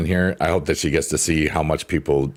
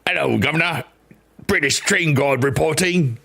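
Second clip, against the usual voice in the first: hammed-up, higher British train guard accent; a deliberately awful British accent, pitched up; bad British accent; 8 semitones higher than usual. Greeting chat as a mock British train guard, in a terrible British accent. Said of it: "the worst accent I've ever done".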